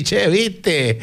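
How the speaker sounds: playful imitation of a Chilean back from Argentina; Argentine accent. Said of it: "volvió todo así". (imitando acento argentino en broma)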